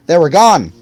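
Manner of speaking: ordinary delivery, nothing marked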